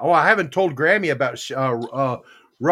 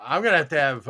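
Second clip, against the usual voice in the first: ordinary talk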